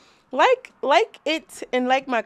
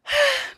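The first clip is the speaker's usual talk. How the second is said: high dramatic sigh. Real, not acted. Dramatic sigh before announcement.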